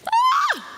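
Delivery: short high squeal of excitement; excited squeal. Retold or imitated. Telling Dom she's ready, a high excited squeal. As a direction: let out a sudden thrilled shriek.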